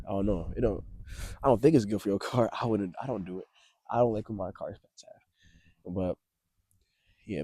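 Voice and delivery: ordinary talk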